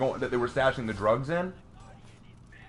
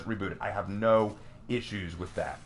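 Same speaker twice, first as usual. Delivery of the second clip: ordinary delivery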